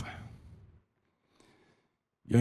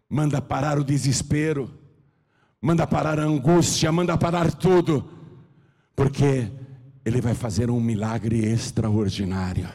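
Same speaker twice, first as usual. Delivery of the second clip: ordinary talk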